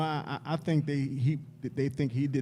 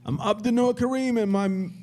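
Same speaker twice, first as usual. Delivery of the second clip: loud, higher-pitched mock Arabic voice; 6 semitones higher, 5 dB louder than usual. Host introducing himself to the listeners in a put-on Arabic voice. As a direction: put on a playful Arabic accent. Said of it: "Arabic voice".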